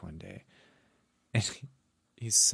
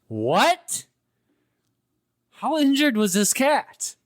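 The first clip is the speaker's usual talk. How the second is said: much higher and louder, rising disbelief; can't believe what you just heard; incredulous; 7 dB louder, slower than usual. Real, not acted. Telling a friend the cat story, loud high disbelief.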